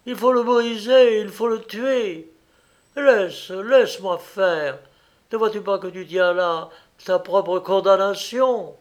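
high, forceful outburst; frantic urging. A narrator's reading. Wife struggles to seize the violin, frantic urging.

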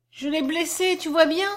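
put-on upset little girl's outcry; distressed broken cry. A narrator's reading. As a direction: cry it out high, with understated anguish. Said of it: "cria-t-elle d'une voix entrecoupée".

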